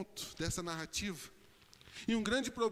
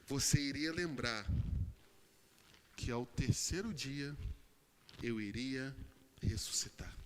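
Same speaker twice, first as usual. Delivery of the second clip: low, weighty voice as Jesus; solemn reminder; 6 semitones lower than usual. Acted. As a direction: drop low and let the promise sink in. Preacher voices Jesus reminding Mary. (lembrança solene em voz grave)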